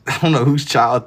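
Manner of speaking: laughing while denying it; amused, laughing through the words; laughing it off to chat. Real, not acted. Laughing at chat's baby comment.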